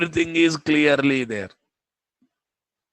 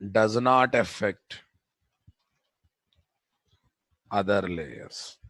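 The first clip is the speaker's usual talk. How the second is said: slow dictation; 4 dB louder than usual. Shown as a demonstration.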